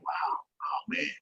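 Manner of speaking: breathless, slow, soft "wow, oh man"; awed hush. Retold or imitated. To a friend, still amazed at the honor, breathless soft awe. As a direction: let the wonder leave you wordless. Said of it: "Till this day, I'm still like".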